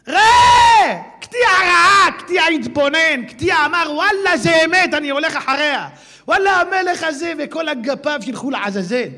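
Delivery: loud, high impassioned preaching; fiery preaching. Acted. Preacher roars Ketia's moment of decision. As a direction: build to a roaring climax. Climax of the sermon.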